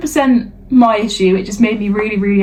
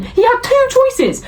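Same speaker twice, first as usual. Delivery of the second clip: higher, slow exasperated emphasis; exasperated rant; 9 semitones higher, slower than usual. Real, not acted. Ranting to a friend about a motorcyclist, high and slow. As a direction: drag it out in disbelief.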